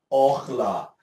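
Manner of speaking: "Orla" opening on an O sound; pronounced with O. Shown as a demonstration. Teacher repeats the Hebrew word for the class, "Orla" with O. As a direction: repeat "Orla" clearly, O as the first vowel. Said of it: "A pronúncia é".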